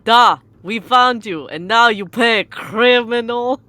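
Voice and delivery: lightly put-on Russian accent, menacing; hammy Russian cop catching a criminal; mock Russian accent. Russian-accented detective threatens the caught criminal, mock accent. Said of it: "You are Russian".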